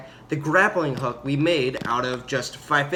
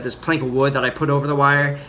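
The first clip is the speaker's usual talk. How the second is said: ordinary talk, nothing marked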